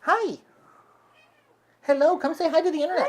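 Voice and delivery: high, loud cooing to a cat; pet talk. Real, not acted. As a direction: coo at the kitty, quick and bright. Greeting the cat.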